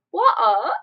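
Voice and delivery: loud, exaggerated British "water"; hammed-up British accent, breaking into laughter. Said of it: "and then they say, like".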